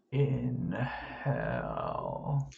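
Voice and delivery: a hint of a hollow skull voice. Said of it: "jawbone just goes".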